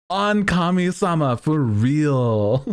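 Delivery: mock-reverent slang, slowed right down; hammed-up slang quote. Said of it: "it was so good".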